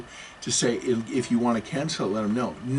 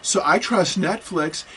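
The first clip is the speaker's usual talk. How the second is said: mock duped victim; 6 semitones higher, slower than usual.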